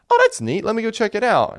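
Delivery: high-pitched, light interest; intrigued quoted reaction. Retold or imitated. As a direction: act out perking up at a tip, voice jumping up. Quoting own reaction to friend's tip.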